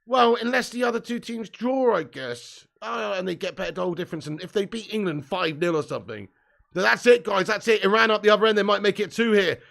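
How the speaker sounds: ordinary talk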